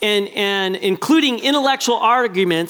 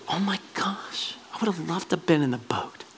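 breathless whisper, much softer; 4 semitones lower, 10 dB quieter than usual. Real, not acted. Preacher wishing he were in the boat, hushed whisper. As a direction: lean in and whisper your wish.

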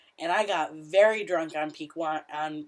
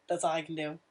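ordinary talk, nothing marked

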